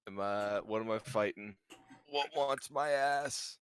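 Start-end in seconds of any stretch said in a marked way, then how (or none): 2.1-3.6 mock bravado. Player calls out a foe.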